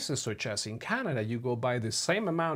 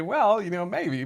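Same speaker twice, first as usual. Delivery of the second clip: sheepish reply; 8 semitones higher, 7 dB louder than usual. Retold or imitated. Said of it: "like I say".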